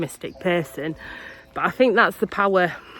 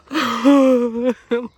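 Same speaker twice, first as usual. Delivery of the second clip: loud laugh; 7 semitones higher, 8 dB louder than usual. Real, not acted. Laughing at her own crying.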